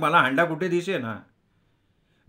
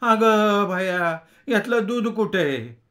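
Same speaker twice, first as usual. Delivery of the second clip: high, drawn-out rustic cry; shocked cry; 6 semitones higher, 3 dB louder, much slower than usual. A narrator's reading. The milkmaid finds her milk pot empty and cries out. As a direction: yelp as if stung by a scorpion. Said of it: "Ingali डसल्यागत ओरडली".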